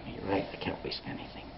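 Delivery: soft, then fading to a whisper; whispered admission. Acted. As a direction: concede it under your breath. Yaakov gives in, humbled.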